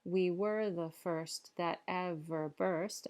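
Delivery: "The fair breeze blew" slowly, each beat marked; slow scanned verse. Shown as a demonstration. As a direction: count the iambs out slowly. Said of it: "Again, eight".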